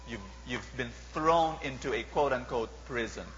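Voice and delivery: ordinary talk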